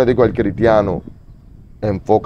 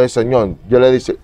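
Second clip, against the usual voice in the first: ordinary talk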